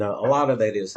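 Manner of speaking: ordinary talk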